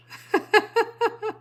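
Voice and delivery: a real giggle after the joke; high laugh. Real, not acted. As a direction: burst of high-pitched laughter.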